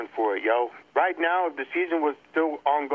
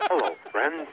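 Jim Nantz impression; 7 semitones lower than usual. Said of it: "What is he, Jim Nantz?".

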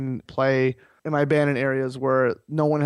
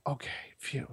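breathy soft phew, much softer; relieved sigh; 15 dB quieter than usual. Retold or imitated. Hearing the case is fixed, soft relieved phew. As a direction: let the relief out quietly. Relief after judge's ruling.

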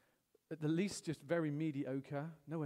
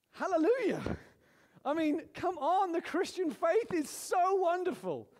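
high, loud joyful exclamation; preaching at full force; excited praise; 11 semitones higher, 6 dB louder than usual. Real, not acted. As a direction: burst out praising God.

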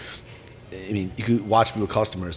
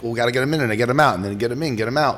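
rushed producer voice; 3 semitones higher, 3 dB louder, much faster than usual. Retold or imitated. Rushed staff voiced in story.

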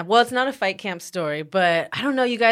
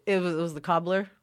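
usual speaking voice

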